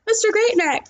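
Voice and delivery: a touch of a kindly nurse. A narrator's reading. Nurse praising the boy, lightly voiced. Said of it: "the nurse said".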